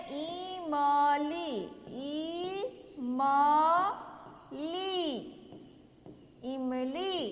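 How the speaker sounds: इमली very slowly in separate beats; drawn-out syllables. Shown as a demonstration. Teacher modelling इमली for children, syllables drawn out. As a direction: stretch each syllable of इमली, long final ee.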